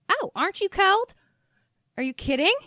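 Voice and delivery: put-on high, loud incredulous Southern-accented mom. Mom reacts to her child liking nakedness, incredulous Southern accent. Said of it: "this weird accent that I'm choosing".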